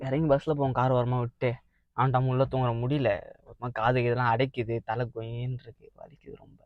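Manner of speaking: low, soft, fading away; groggy complaint. Acted. Drunk son complaining of an aching head, groggy and fading. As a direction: trail off feebly with a sore head.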